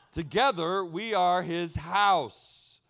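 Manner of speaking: Together we are his house drawn out slow and loud; slow emphatic reading. Acted. Pastor reading scripture to the church, each word slow and loud. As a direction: lean on every word, slow and strong.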